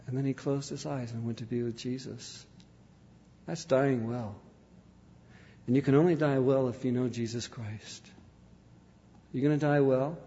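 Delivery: soft, grave altar call; hushed sermon appeal. Real, not acted. Preacher urges listeners to choose Christ, hushed appeal.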